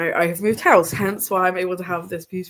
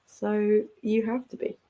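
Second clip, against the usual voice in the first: high, soft sing-song to the cat; baby talk to a pet; 5 semitones higher, 3 dB quieter than usual. Pretend. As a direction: coo at a fluffy kitten. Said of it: "And I'm like".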